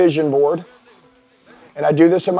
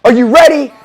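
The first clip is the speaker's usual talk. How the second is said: shouting, much higher and louder hype-up; high energy hype; 9 semitones higher, 11 dB louder than usual. Retold or imitated. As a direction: pump up the room like a gym coach. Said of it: "My gym is high energy".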